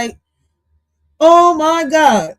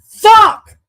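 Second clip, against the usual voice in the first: sudden loud curse, higher and louder; exasperated yell; 9 semitones higher, 9 dB louder than usual. Real, not acted. Reacting to [name] in the clip, an exasperated yell. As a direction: blurt it out, fed up.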